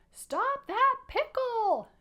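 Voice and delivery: high, loud, drawn-out yell; upset shout. A narrator's reading. Mister Adolph gives chase to the pickle, upset high shout. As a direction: shout it after a runaway, very high and stretched. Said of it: "shouted Mister Adolph".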